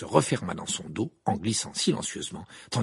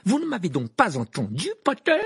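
loud question leaping up at the name; high, indignant teacher voice; sharp rebuke; 9 semitones higher, 6 dB louder than usual. A narrator's reading. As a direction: scold a pupil, stabbing the last word. Snape rebukes Harry.

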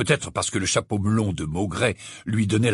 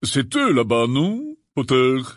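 put-on gruff old auror; slower than usual. Gruff auror asks Harry.

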